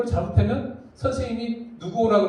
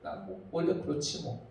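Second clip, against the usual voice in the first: whispered defeated sigh; resigned whisper; 7 semitones lower, 10 dB quieter than usual. Retold or imitated. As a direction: give up in a soft whisper. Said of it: "이렇게 돼버리는 거예요". (체념한 듯 속삭이는 목소리)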